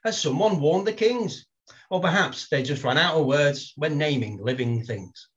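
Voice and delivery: measured, lightly lilting poem recital; gentle comic poem reading. Acted. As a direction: read it out like a performance poet. Reads his poem Names.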